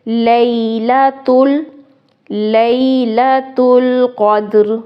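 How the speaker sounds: slow chanted tajweed recitation; Quran recitation. Shown as a demonstration. Teacher recites the whole verse for students. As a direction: recite the verse slowly and melodically.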